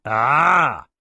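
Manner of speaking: a loud knowing ah; troll's gloating ah. A narrator's reading. Troll spotting Edgar, gloating ah. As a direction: pounce on the word. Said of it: "said the troll".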